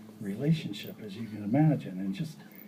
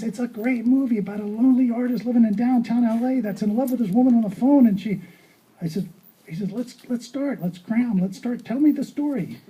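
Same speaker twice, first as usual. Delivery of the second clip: excited pitch; 7 semitones higher than usual. Retold or imitated. Friend's excited pitch retold.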